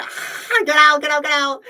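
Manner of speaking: very high, loud shriek; shrieking get out. Real, not acted. Screaming at the fan to get out, a high shriek. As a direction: shriek them out the door.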